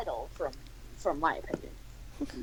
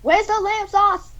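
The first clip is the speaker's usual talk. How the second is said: high, insistent caricature; comically high put-on demand; Gordon Ramsay; 9 semitones higher than usual. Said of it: "this is Gordon Ramsay".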